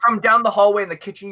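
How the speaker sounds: ordinary talk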